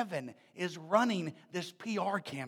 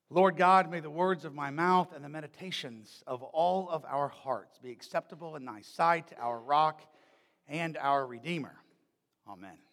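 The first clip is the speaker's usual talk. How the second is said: prayer; 6 semitones lower than usual. Acted. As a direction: pray on behalf of the congregation. Leads opening prayer.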